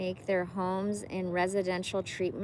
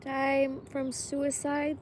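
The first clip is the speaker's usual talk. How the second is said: 1 tearful slow reading; 6 semitones higher, slower than usual. Real, not acted. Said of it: "read this without crying".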